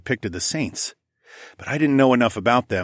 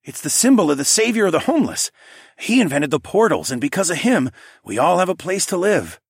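higher, fast, fervent townsman; Steve voice; 9 semitones higher, 4 dB louder than usual. A narrator's reading. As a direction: rush it out with awe. Steve praises the savior.

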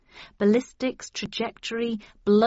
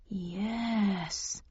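soft, drawn-out single word; slow, hesitant; 6 dB quieter than usual. A narrator's reading. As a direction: stretch it out quietly, thinking it over. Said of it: "said Jack slowly".

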